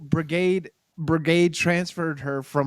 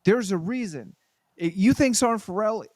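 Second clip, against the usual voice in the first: normal talking voice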